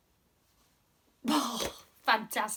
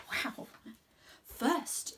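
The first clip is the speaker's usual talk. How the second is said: plain reading voice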